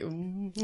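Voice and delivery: soft, low embarrassed "ooh"; small hushed sound of awkwardness. Acted. Peering around the side, embarrassed ooh. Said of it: "an embarrassed face just peering around".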